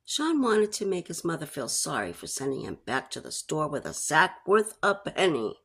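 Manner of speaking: plain reading voice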